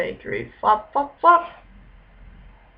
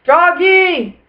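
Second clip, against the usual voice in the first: father calls Froggy, higher and louder; calling out; 7 semitones higher, 5 dB louder than usual. A narrator's reading. Father calls Froggy from another room, loud call. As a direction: call across the house. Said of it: "called his father".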